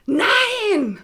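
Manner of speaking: high, loud furious cry; furious scream. Acted. Actress losing a role, screaming in anger. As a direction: scream it in outrage. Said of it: "schrie Mandy Cheyenne wütend auf".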